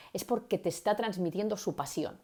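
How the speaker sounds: usual speaking voice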